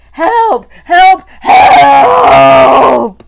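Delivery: high, loud terrified shouts; boy screaming toward the door for rescue; screaming for help. A narrator's reading. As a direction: yell for anyone outside. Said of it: "I screamed".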